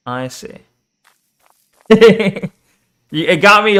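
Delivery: usual speaking voice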